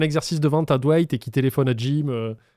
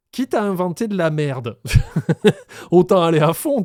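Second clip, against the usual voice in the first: laughing; 3 semitones higher, 6 dB louder than usual. Real, not acted. Rit en racontant son plan.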